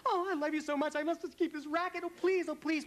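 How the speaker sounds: high, gushing mock plea from a fawning fan; pleading gushing fan. Woman at the airport begs Linda to keep the racket, high hammed-up pleading. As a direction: ham up a fan begging, voice soaring.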